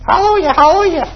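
high, fast double hallelujah; high excited hallelujah. Real, not acted. Preacher to congregation, high quick excited praise.

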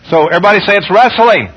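ordinary talk

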